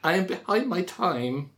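high, meek clerk voice, stammering an apology; timid clerk. Acted. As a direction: squeak it out like a frightened employee. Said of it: "said Skunk".

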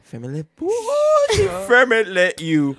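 drawn-out, high swooping mock French. Teasing a friend's bad French, drawn-out mock French. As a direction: stretch it out absurdly, breaking into laughter. Playfully echoing the French phrase.

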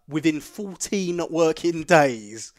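drawn-out agent quote. Retold or imitated. Quoting the agent's repair wait to a friend, drawn out. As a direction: spell out the wait very slowly.

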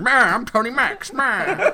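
high put-on voice of a man in disguise; fake Tony Max. Priest in a fake mustache posing as Tony Max. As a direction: ham up the fake celebrity. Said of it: "with a fake mustache going".

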